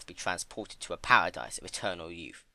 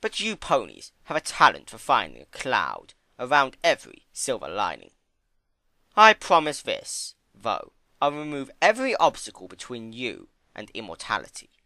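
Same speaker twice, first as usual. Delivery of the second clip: Discord; 3 semitones higher, 6 dB louder than usual. Acted. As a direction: lay it on thick. Discord voiced.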